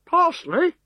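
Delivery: high, doubtful "Parsley?". Acted. Legionary echoing his officer, high and doubtful. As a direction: repeat "Parsley?" rising, unconvinced.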